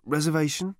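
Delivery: usual speaking voice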